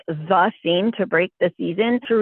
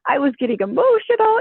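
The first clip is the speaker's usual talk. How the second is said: bragging; 10 semitones higher than usual. Retold or imitated. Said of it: "she was bragging how she was acting".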